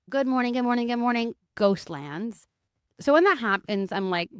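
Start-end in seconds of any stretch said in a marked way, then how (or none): 0.1-2.4 cheery greeting. Real, not acted. Greeting the audience mid-story.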